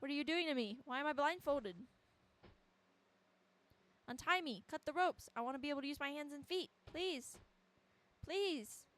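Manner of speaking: high-pitched, desperate begging; acted panicky child's plea; frightened pleading. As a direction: beg your captor to free you. Acts bound child pleading.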